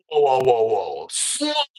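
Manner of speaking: retold startled halt. Halting the mom mid-revelation, taken aback. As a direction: cut her off in mock disbelief, dropping then jumping in pitch.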